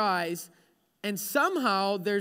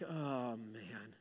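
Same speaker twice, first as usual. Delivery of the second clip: very low, soft weary sigh of words; just woken and defeated; drained waking groan; 11 semitones lower, 15 dB quieter than usual. Acted. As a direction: sag into it, exhausted. Waking drained as the alarm beeps.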